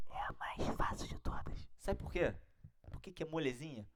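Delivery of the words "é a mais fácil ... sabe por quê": secretive whisper, sharing a trick. Shown as a demonstration. Teacher whispering the easy rule to students. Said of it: "Vem comigo aqui, ó".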